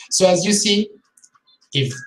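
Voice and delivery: ordinary talk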